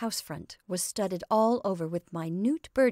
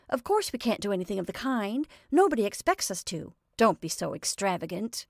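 usual speaking voice

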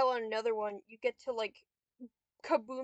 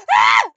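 short high scream; very loud, high shriek; sudden scream at the game; 19 dB louder than usual. Real, not acted.

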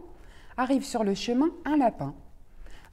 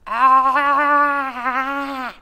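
wide-open ah; 2 semitones higher, 6 dB louder than usual. Acted. Wolf opens wider.

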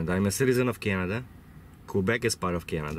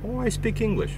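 much higher, cheerful imitation of the girl; young cashier; 14 semitones higher than usual. Cashier telling the trucker she speaks English, high and breezy. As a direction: pop it up high like a surprised young clerk. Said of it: "and she says".

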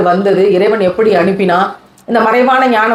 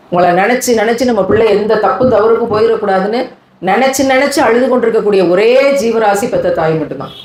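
ordinary talk, nothing marked

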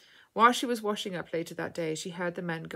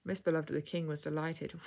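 usual speaking voice

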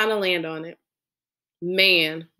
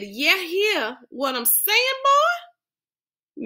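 put-on copying hillbilly accent; 11 semitones higher, much slower than usual. Boy copies dad's hillbilly voice.

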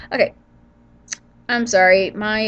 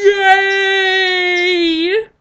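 mock cheer; 9 semitones higher, 5 dB louder than usual. Sarcastic cheer at allergies.

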